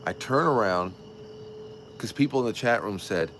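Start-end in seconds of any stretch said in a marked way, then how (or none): none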